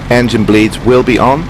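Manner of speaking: normal talking voice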